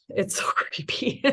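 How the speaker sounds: whispered with a laugh; creepy whisper. Real, not acted. Mom telling a friend the night terrors creep her out, whispering. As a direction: breathe it out like a spooky secret.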